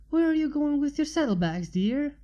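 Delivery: a lightly voiced mother pony calling out; motherly baker. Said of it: "she asked me".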